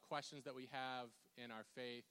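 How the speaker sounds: ordinary talk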